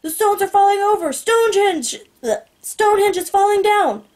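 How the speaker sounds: high, urgent cries for help; yelling. A narrator's reading. Boy distracting guards with falling stones, yelling. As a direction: yell out the alarm. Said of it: "that's when Eight starts yelling".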